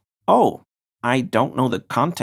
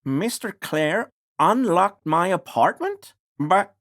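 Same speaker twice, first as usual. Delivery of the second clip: shocked and climbing higher and higher; rising disbelief; 7 semitones higher than usual. Acted. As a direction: caught completely off guard, pitch spiking on each word. Character learns of break-in.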